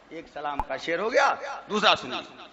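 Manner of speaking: normal talking voice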